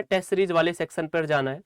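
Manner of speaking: normal talking voice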